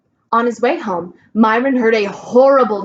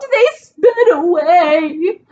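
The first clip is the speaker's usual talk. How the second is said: sobbing; 7 semitones higher, 4 dB louder, much slower than usual. Acted. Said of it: "Dana sobbed".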